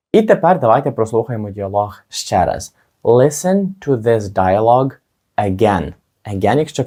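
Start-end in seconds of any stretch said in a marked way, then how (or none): none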